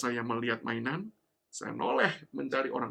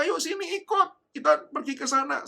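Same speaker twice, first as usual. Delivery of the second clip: coaxing stranger; 13 semitones higher, 7 dB louder than usual. Retold or imitated. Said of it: "Orang-orang di sekitar mulai berkata".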